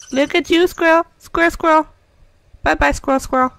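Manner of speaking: high, loud sing-song; playful calling. Real, not acted. Player calls out to a squirrel, high sing-song. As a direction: call to the critter playfully.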